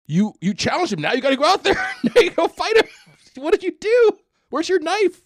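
very high, loud, hammed-up alarm with laughter; mock goading. Host acts out bystander pushing chef to fight, high and frantic. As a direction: egg him on in a squealing mock voice.

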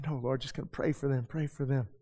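soft humble aside to God; quiet prayer. Retold or imitated. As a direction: hush down and just pray for them. Humble prayer contrasted with old nature.

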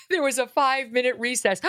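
fake-weeping over a trivial memo; mock tearful reading.

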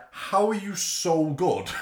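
high, loud, drawn-out disbelief; retold amazement. Host retelling awe at the actress's talent, amazed. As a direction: stretch out an amazed question.

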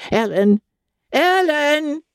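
put-on an old lady calling across the lawn, climbing in pitch; shrill old cry. A narrator's reading. Old grandmother calling out to Ellen on the lawn, rising call. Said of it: "she cried in her shrill old voice".

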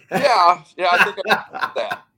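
thrilled to say yes; eager yes. Retold or imitated. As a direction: jump at the offer with a laugh. Accepting the NFL job offer.

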